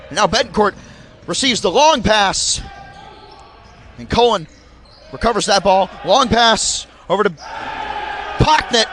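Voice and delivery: brisk live sports call; play-by-play commentary. Acted. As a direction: call the game as it happens.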